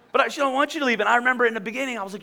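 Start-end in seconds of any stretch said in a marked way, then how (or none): 0.3-1.0 higher imitation of a pleading wife. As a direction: plead like a newlywed, put on. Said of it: "talk in that voice".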